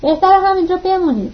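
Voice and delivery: shouting. A narrator's reading. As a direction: yell it after them as they leave. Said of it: "جنسن فریاد زد".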